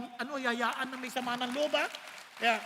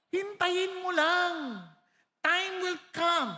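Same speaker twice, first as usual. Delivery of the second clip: very slow, loud, deliberate words; slow emphasis; 4 semitones higher, 5 dB louder, much slower than usual. Real, not acted. Preacher assuring the church time will prove God right, very slow and loud. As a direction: stretch every word out, patiently insistent.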